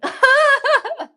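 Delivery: sudden high laugh at herself; bright burst of laughter. Real, not acted. Laughing at her own joke about being lazy.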